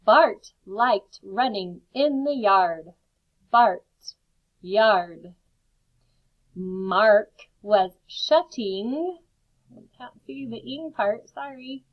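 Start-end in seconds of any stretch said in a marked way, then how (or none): 0.0-2.9 Bart stressed and drawn out; slow teacher read with Bart hit; slow model sentence. Shown as a demonstration. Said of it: "that pirate sound". 6.5-9.2 very slow read. Shown as a demonstration. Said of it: "teacher models reading".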